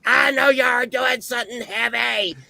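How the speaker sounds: high-pitched scolding shout; screaming aunt. Retold or imitated. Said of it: "she's screaming at him".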